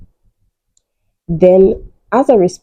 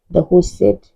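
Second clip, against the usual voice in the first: ordinary talk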